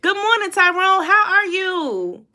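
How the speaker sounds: high, stretched out welcome, slow on how are you; sing-song greeting. Real, not acted. Streamer greeting viewer Tyrone in a high sing-song hello. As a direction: sing the hello up bright to a friend.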